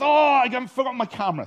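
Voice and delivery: high, loud, rushed exclamation; flustered mate exclaiming. Retold or imitated. Mate remembering his camera before running off, flustered. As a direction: blurt it out in a fluster.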